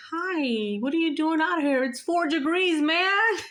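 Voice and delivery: high, exclaiming mock scolding; high-pitched concern. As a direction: fuss at him in a high, rising voice. Acts out her concerned greeting.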